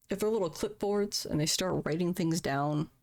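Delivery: ordinary delivery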